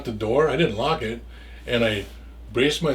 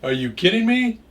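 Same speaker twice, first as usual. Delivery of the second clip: higher and louder, are-you-kidding disbelief; retold disbelief; 7 semitones higher, 4 dB louder than usual. Told he owes Sarah money, shocked and raised. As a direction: throw it back in shock. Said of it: "I'm like".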